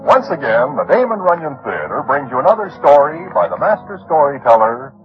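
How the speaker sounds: usual speaking voice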